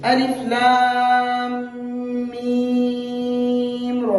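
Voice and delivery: slow, drawn-out melodic chant; Quran recitation. Shown as a demonstration. Teacher reciting letters for students, slow melodic chant. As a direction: stretch each letter name like tajweed recitation. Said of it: "पढ़ते हैं".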